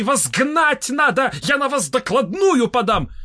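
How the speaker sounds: high-pitched outraged threat; shouting. A narrator's reading. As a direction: scold in a high, strained-up pitch. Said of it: "закричал Толстый". (возмущённая угроза высоким голосом)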